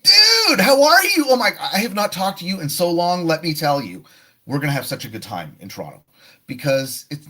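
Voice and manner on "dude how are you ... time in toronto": much higher, loud excited greeting; the right way, bubbly and loud to a friend; energetic friend. Shown as a demonstration. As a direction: gush to your old friend. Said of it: "No, it'd be".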